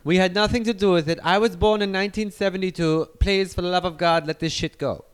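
put-on German accent, pleading; heavy German accent, defensive; German guy. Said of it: "It's the German guy".

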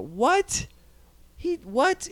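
can't believe the casting news; incredulous. Real, not acted. Outraged disbelief at casting news.

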